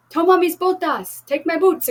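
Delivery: high, loud urgent cry; urgent exclaim. Acted. Carlos hands his boots to the llama, loud and urgent. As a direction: Carlos calls out urgently, pitch way up. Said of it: "exclama Carlos".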